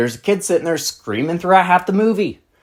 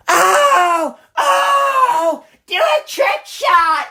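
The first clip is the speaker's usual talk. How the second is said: put-on rowdy kid voice; much slower than usual. Mocking neighborhood kids on scooters.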